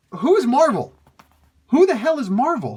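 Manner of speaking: loud, high-pitched incredulous demand; exasperated disbelief. Real, not acted. Reader ranting at a baffling interview credit, exasperated disbelief. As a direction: demand an answer, voice jumping up high.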